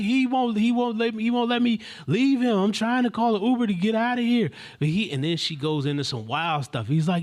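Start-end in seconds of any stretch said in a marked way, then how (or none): 0.0-4.5 high, loud, insistent holler; imitated hysterics. Young woman explaining she can't get away, imitated hysterics. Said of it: "She's in hysterics".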